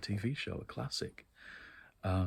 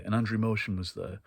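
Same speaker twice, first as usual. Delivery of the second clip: normal talking voice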